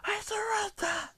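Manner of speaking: very high, strained squeal; squealed plea for mercy; mock desperate shout. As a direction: cry surrender in a drawn-out falsetto. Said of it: "Sludge shouts".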